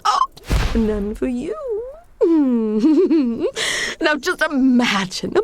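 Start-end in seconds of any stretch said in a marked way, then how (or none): 2.2-4.0 high mocking villain cackle; evil cackle. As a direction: cackle in his face. Denying him the pie.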